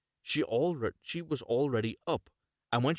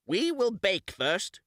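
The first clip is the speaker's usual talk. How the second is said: higher, louder, slow witch voice; old witch; 9 semitones higher, 4 dB louder, slower than usual. Acted. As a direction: savour the plan, drawn out slowly. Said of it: "said the old woman".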